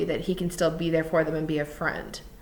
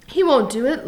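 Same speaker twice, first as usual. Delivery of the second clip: higher, louder protest; crying out; 7 semitones higher, 7 dB louder than usual. A narrator's reading. Lenny insisting George won't do it, crying out. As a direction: cry it out in protest. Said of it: "Lenny cried".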